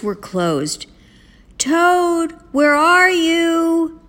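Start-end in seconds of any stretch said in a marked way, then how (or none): 1.6-4.0 high, slow, drawn-out call; sing-out search call, pitched way up. A narrator's reading. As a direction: call through a dark house for someone. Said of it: "called Frog".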